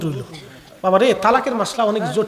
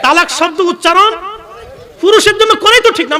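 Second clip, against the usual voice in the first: higher and louder, preaching hard; loud emphatic repeat; 9 semitones higher, 7 dB louder than usual. Shown as a demonstration. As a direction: drive the point home from the pulpit. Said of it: "কি কথা কন না?". (জোরালো উঁচু স্বরে প্রচার)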